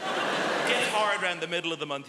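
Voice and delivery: ordinary delivery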